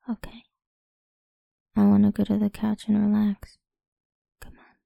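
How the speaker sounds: usual speaking voice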